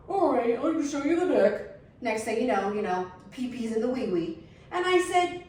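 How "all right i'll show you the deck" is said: slow, slightly higher dopey dude voice; mock the naive husband going along; clueless husband. Husband agreeing to show the deck, slow dopey mock voice.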